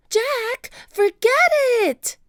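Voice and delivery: high, loud, exasperated yell; high-pitched pleading holler; urgent shout. A narrator's reading. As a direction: yell high to stop a friend. Annie yells to Jack.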